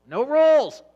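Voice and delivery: bursting out loud and high on the punchline; shouted. Acted. Preacher to congregation, shouting the kids' motto. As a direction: yell it like a rallying cry.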